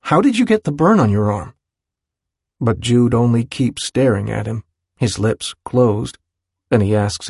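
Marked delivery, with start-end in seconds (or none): 0.0-1.5 higher, quick, pressing question; insistent repeat. A narrator's reading. Willem repeats his question to his friend, insistent. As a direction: demand an answer, voice raised in pitch. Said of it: "he repeats".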